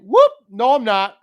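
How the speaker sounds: high, loud teasing whoop at mom's slip; mock whoop. To mom on the phone, a high loud mock whoop. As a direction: catch her out playfully.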